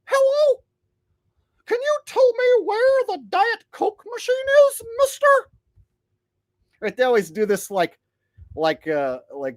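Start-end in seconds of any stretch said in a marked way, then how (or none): 0.0-5.3 lamest whitey-tighty voice. Acted. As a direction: pipe it up high, painfully square. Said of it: "whitey tighty in the world type voice".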